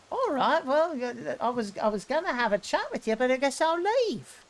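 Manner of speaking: high, miffed voice, slighted and leaving; huffy spirit voice. Acted. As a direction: sulk off with a high, offended sigh.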